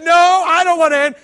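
loud, high protest; hammed-up loud refusal; insistent shout. Retold argument over girl.

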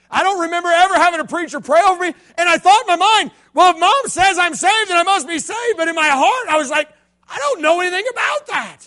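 high, loud preacher's cry; preaching at full force. Acted. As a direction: lift it to the back row.

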